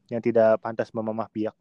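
ordinary delivery

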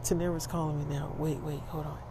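quick aside under the breath; quiet, keep-it-down voice; hushed interruption. Acted. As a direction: softly put him on hold. Interrupted in theater by a call.

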